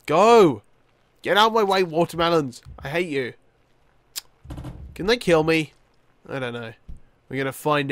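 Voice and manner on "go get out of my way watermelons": loud, raised command; yelling at obstacles. Real, not acted. Gamer to watermelons blocking the runner, loud yelling. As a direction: shout them out of the way.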